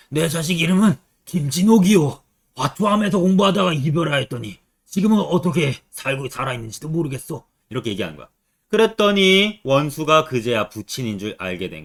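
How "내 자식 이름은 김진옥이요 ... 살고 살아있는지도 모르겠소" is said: lightly voiced old man of a classical tale; a hint of an old man's lament. Old man telling of his lost child, lightly acted lament. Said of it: "노인이 얘기합니다".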